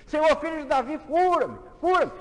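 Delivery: grito agudo e muito forte, crescendo; cego gritando. Acted. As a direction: clama cada vez mais alto. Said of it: "O cego começou a gritar". (Bartimeu gritando a Jesus, cada vez mais alto)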